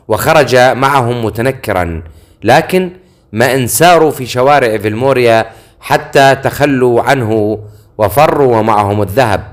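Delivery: plain reading voice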